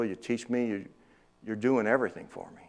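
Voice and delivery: ordinary talk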